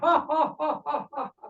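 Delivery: rhythmic "ha-ha" laugh, imitated; mock laughter. Happy stranger laughs instead of answering, mock laughing fit. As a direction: answer with nothing but put-on laughter. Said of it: "फिर हंस रहा है".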